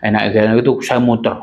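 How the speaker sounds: ordinary talk, nothing marked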